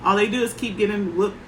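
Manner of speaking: usual speaking voice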